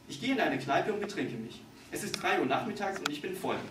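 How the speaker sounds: usual speaking voice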